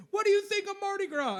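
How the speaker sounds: high, loud reporter imitation; mock news reporter. News crew stops man at parade, mock high reporter voice. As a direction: pounce with the question in a high voice.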